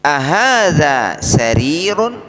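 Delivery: teacher's slow demo question; slow model question. Shown as a demonstration. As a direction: model the question slowly for repetition. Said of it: "pakai kata tanya a".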